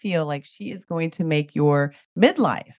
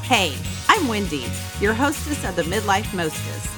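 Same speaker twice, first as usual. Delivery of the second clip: upbeat host intro read; podcast intro. Acted. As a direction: read it like a show opener. Podcast intro read over music.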